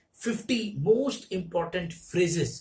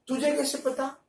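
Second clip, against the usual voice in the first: higher, surprised hindi; higher-pitched surprised question; 6 semitones higher than usual. Shown as a demonstration. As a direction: ask it taken aback. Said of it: "तो कहते ना".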